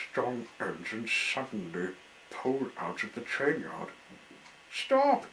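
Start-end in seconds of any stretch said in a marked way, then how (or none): 4.7-5.3 high loud shout; crying out. A narrator's reading. Clown shouting at the departing engine, high and loud. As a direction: yell after the leaving train. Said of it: "cried the clown".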